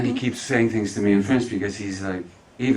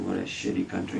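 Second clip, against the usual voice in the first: muttered curse; 5 dB quieter than usual. Retold or imitated. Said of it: "I said".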